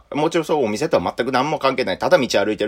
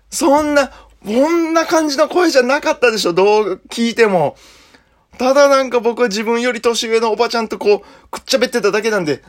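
much higher, louder pleading protest; flustered denial; 12 semitones higher, 4 dB louder than usual. Retold or imitated. A junior frantically denying it to his senior, high and loud. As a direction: panic and deny it in a rush.

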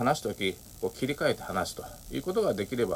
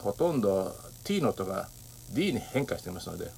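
ordinary talk, nothing marked

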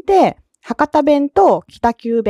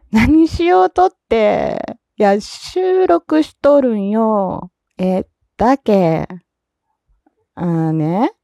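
put-on Fukuoka-accented phone chat, vowels stretched, giggles between replies; Fukuoka dialect. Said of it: "今日の私は福岡弁でした".

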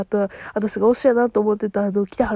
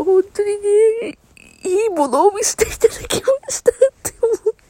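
real crying; 9 semitones higher than usual. Real, not acted. Host moved to tears by the show's ending, speaking through real sobs. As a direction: voice cracking as tears come. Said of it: "リアルで泣いてます".

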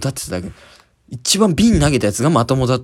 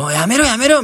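imitating someone breaking up a fight; 9 semitones higher than usual. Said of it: "みたいな".

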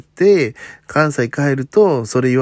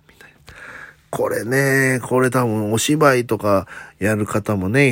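ordinary talk, nothing marked

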